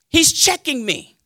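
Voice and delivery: ordinary talk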